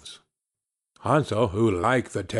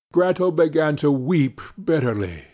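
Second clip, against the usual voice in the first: plain reading voice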